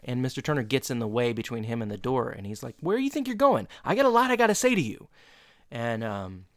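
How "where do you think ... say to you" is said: high-pitched mock angry challenge; acted-out teacher telling off; imitated yelling. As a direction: block his exit with a pitched-up scolding. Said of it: "he yells at him for a minute".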